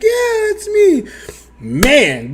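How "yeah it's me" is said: put-on girl's voice. Woman voiced high, drawn out.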